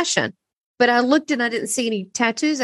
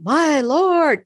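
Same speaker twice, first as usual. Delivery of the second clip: reenacted sudden cry, pitched up; retold involuntary yell; 5 semitones higher, 4 dB louder than usual. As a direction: blurt it out higher, like a retold outburst. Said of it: "I yelled out like Tourette's".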